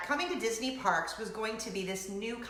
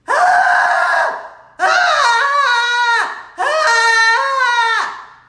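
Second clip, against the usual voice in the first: high, very loud "Ah!" scream; hysterical scream; 13 semitones higher, 17 dB louder, much slower than usual. Retold or imitated. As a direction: scream it out full force, over and over. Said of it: "this is what I remember hearing".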